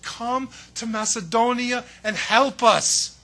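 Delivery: a man begging in a dream, slow and loud; crying out. Retold or imitated. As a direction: call out to him for rescue. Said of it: "he cries out to Paul".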